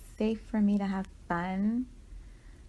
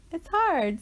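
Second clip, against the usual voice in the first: strained exclamation; 8 semitones higher, 5 dB louder than usual. Pretend. Inner voice straining.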